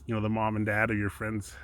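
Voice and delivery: normal talking voice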